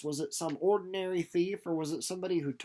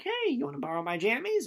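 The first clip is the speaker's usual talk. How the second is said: higher, friendly offering voice; May offering Winnie her nightgown, higher and kind; sweet May offer; 7 semitones higher than usual. Retold or imitated. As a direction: offer kindly like a mother. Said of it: "She's like".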